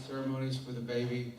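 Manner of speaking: usual speaking voice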